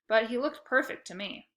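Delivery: plain reading voice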